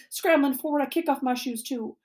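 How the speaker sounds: usual speaking voice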